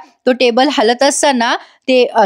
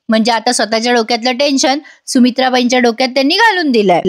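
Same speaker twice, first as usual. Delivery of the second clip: ordinary talk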